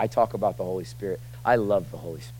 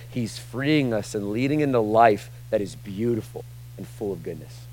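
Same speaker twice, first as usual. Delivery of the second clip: usual speaking voice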